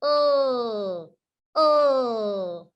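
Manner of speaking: a long drawn-out L sound, written oh. Shown as a demonstration. Teacher modelling the L sound for learners, drawn out. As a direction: hold the L sound. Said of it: "L says".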